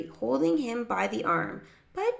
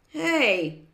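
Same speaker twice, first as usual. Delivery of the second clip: higher, louder startled outburst; exclaiming; 6 semitones higher, 5 dB louder than usual. A narrator's reading. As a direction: exclaim it in protest. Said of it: "exclaimed Jerry".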